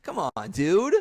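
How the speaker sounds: a frustrated holler; exasperated shout. Retold or imitated. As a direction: yell it at the one who hit you. Mock outrage at the driver.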